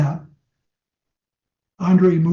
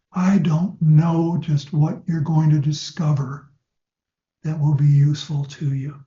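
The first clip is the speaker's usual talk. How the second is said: hypnotic induction demo. Shown as a demonstration. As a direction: lull the client toward closing their eyes. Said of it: "the first words out of my mouth are".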